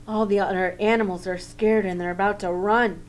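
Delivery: plain reading voice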